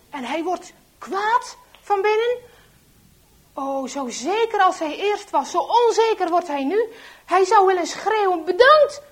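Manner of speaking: loud, high narration building to a shout; rising anger. A narrator's reading. Telling of traitor raging inside at Henk's death, angry. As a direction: build the fury up to a yell.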